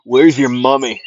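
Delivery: loud, brash taunting jeer; a loud mocking shout at the villain; Bakugo voice. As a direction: heckle him like a cocky rival. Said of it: "Bakugo would say that".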